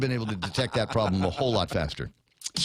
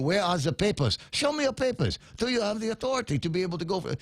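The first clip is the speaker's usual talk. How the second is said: mock Italian accent; 7 semitones higher than usual. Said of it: "in an Italian accent".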